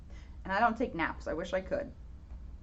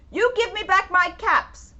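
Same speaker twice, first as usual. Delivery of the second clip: higher, louder demand; scolding peddler; 7 semitones higher, 6 dB louder than usual. Acted. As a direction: insist he wants his caps back. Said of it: "he said, shaking his finger".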